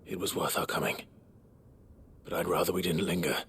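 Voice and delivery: usual speaking voice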